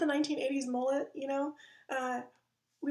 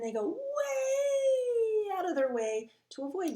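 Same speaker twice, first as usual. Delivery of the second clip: much higher, slow, stretched-out voice; exaggerated drawn-out avoid; 10 semitones higher, 3 dB louder, slower than usual. Comic showing how people swerve to avoid someone, stretched high. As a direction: stretch it out in mock horror.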